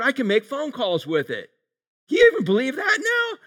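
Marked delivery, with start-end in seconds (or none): 2.1-3.4 shouted high, hammed-up disbelief; high excited shout of fake wonder; mock amazement. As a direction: sell the shovel phone like a wild infomercial. Mock amazement over shovel phone.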